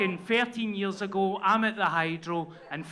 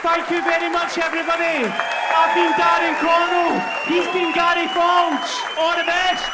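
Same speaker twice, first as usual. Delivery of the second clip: 1 showbiz sign-off; 8 semitones higher, 7 dB louder than usual. Pretend.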